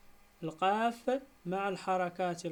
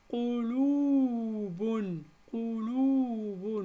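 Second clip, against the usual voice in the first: each syllable stretched slowly and apart; drawn out syllable by syllable for pupils; slow syllable split; 3 semitones higher, 4 dB louder, much slower than usual. Shown as a demonstration. Teacher to pupils, splitting the word, slow and drawn out. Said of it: "نجزئها".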